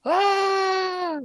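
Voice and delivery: long high-pitched "aah"; drawn-out mock yell; imitated distant yell. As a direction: mimic someone yelling, one held high note. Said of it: "like, this yelling".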